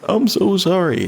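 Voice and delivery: high, put-on sympathy; mock condolences. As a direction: imitate an instant gush of condolence. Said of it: "That's immediate, like, "My condolences".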